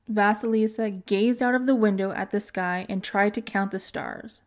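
plain reading voice

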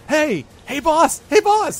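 high, loud goblin calling out; yelling. Acted. Gleek calls to his boss in the bush, yelling. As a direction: yell for the boss in a high-pitched voice. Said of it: "like, yelling at the bush".

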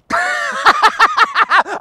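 long, high-pitched laugh; silly high laughing fit; high cackle. Real, not acted. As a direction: cackle at your own joke, way up high. Laughs at his own boast.

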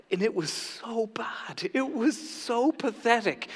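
slow, breath-only stage whisper; whispered confession. Real, not acted. Pastor confessing his failed French, stage whisper. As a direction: confess the embarrassment in a drawn-out whisper.

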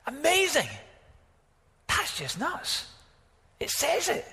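very high, squeaky-pitched wonder; amazed disbelief. Acted. Preacher marvels to congregation at what scripture says, incredulous. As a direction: let the pitch leap up in sheer disbelief.

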